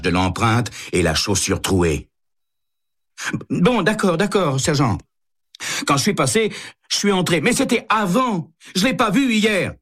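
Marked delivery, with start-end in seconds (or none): 3.5-9.7 high, rattled confession from a cornered man; backing down, pitched high and anxious; nervous suspect. Acted. Fischer gives in, nervous.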